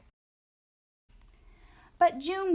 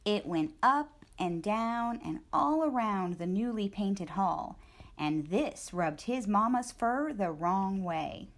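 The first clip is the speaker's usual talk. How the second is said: ordinary delivery, nothing marked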